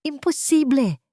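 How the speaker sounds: high shocked exclamation; exclaiming. A narrator's reading. Ricardo seeing the police arrive, shocked. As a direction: blurt it out in disbelief. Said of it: "bulalas niya".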